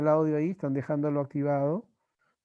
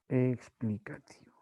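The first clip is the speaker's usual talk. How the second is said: whisper; 4 semitones lower, 6 dB quieter than usual. Real, not acted. Teacher whispers answer.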